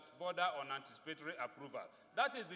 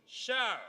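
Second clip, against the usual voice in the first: shall hit much louder and higher; stress on shall; 5 semitones higher, 6 dB louder than usual. Shown as a demonstration. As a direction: bark out shall as the key word. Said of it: "again emphasis on shall".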